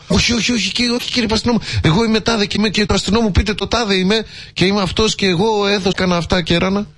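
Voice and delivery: high, quick and pleading, a scared man begging the policeman; frightened pleading. Retold or imitated. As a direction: beg him high and fast not to arrest you.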